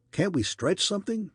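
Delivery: demanding question. A narrator's reading. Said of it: "demanded Wilson".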